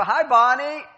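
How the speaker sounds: loud cheery greeting. Retold or imitated. Ranch hands greet the wife with a loud, cheerful hello. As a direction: call out a bright hello across the yard.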